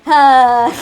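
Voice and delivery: loud exhale into imitated chuckle; villain's laugh. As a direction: burst out, then chuckle as the villain. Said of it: "He laughs, he laughs".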